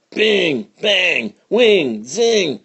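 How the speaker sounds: high, ringing bullet sounds; gunshot noises. A narrator's reading. Narrator imitating bullets flying past the stagecoach, high ringing noises. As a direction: sing out each shot like a ricochet.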